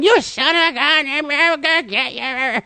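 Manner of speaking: high, strained parrot voice swearing revenge; angry parrot. Retold or imitated. Parrot under the blanket threatening the narrator, high squawk. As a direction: play the furious bird pinned under a blanket. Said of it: "falls to the floor going".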